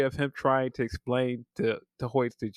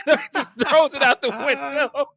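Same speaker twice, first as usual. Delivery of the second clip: high, loud, laughing through the words; 8 dB louder than usual. Real, not acted. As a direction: crack up while telling it. Cracking up while retelling gag.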